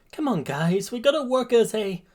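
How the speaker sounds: high-pitched, urging teammate; piping up. A narrator's reading. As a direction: pipe up to rally the others. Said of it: "Midoriya pipes up".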